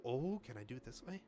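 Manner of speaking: usual speaking voice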